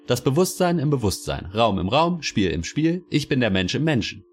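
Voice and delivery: eager official explaining with delight; cheerful official. A narrator's reading.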